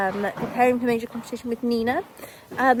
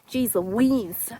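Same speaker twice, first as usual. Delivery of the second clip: ordinary talk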